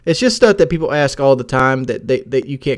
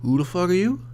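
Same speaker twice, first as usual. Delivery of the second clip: a hammed-up Southern accent, pitched up; 4 semitones higher, 5 dB quieter than usual. Answering strangers at his door in a put-on Southern accent. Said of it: "my big ass Southern accent".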